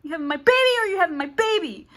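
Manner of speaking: high, loud joking ultimatum; hammed-up pushy suitor; mock demand. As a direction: belt it out as a cocky demand. Man's ultimatum, loudest.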